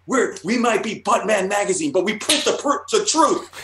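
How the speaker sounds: very high, hammed-up indignant editor; mock outraged tirade. Artist imitating the editor who lost it, outraged. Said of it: "She went off on me".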